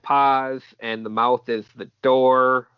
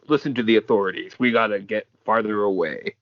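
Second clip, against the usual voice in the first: ordinary talk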